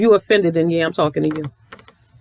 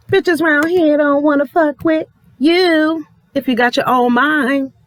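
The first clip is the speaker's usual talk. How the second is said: higher, sneering voice; lightly raised mocking tone; 7 semitones higher than usual. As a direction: keep the mocking edge going. Continuing high sneer.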